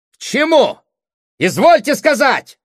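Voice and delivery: very high, loud demand; thunderous shout. A narrator's reading. Brother demanding his sister explain, thunderous shout. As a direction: roar the challenge furiously. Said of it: "вскричал громовым голосом".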